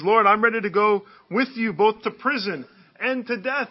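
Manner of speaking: loud, high, earnest declaration; ringing, loud pledge of loyalty; fervent vow. Acted. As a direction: proclaim it boldly as a vow. Peter's vow quoted in sermon.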